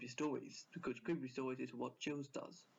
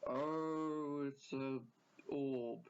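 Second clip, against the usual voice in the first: light mock of a YouTuber's slow voice; slow, drawn-out imitation of a narrator; a hint of a monotone video host; imitating Chills; much slower than usual. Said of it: "Chills says it like".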